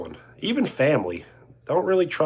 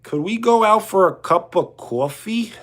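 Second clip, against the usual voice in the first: higher, put-on Long Island voice; Long Islander accent; 6 semitones higher than usual. Streamer asking someone out for coffee, Long Island accent. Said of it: "how do they talk?".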